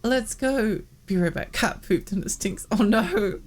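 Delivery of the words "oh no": usual speaking voice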